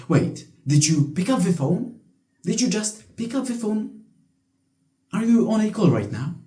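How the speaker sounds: retelling the teacher's outraged question; incredulous teacher. Retold or imitated. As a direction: play the shocked teacher catching him. Teacher catches student on phone.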